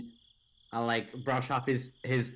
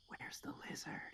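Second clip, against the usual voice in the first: unvoiced, close menacing whisper; 19 dB quieter than usual. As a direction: breathe it right in his face. Player leans close, menacing.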